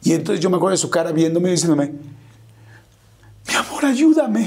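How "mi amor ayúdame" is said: high, slow, helpless plea; tender, vulnerable plea. Retold or imitated. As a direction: voice climbing as it pleads slowly. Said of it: "completamente tierno en".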